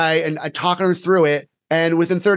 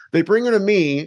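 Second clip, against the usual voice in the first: normal talking voice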